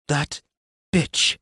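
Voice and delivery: sudden furious outburst; furious curse. Acted. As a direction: spit the curse out. Maxine furious at betrayal.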